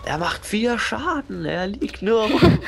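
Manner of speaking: high, slow outraged whine. Real, not acted. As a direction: drag it out in disbelief. Outraged mocking of enemy.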